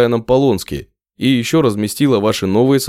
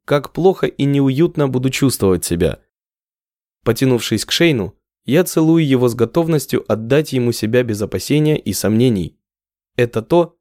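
usual speaking voice

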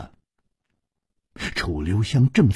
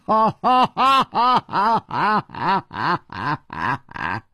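big laugh; 7 semitones higher than usual. Acted. Nan Gongling answering the threat with a long laugh. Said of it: "南宫玲也大笑".

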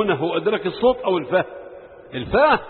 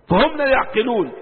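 ordinary delivery

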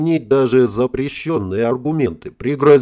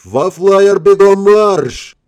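high, loud barked parade commands; shouting drill sergeant; 8 semitones higher, 7 dB louder, much slower than usual. A narrator's reading. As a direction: bark orders like a sergeant. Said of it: "грозно зарычал".

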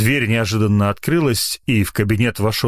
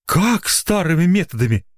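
high-pitched, taken-aback question; surprised; 9 semitones higher than usual. A narrator's reading. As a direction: echo it in astonishment, pitch jumping. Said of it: "удивился Маргуля".

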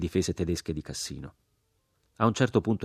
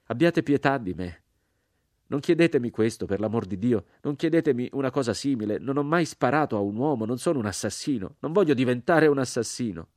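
higher, louder desperate begging; raised pleading voice; pleading cry; 6 semitones higher, 3 dB louder than usual. A narrator's reading. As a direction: refuse in anguish.

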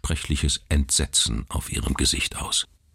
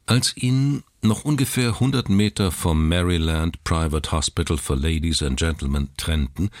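usual speaking voice

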